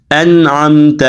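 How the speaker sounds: انعمت as 'an-amta', n closed with no vowel after it; correct held nun. Shown as a demonstration. Teacher demonstrates for students, انعمت with a firm silent nun. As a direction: hold the n firmly in انعمت before the ain. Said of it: "کو ذرا پکڑے".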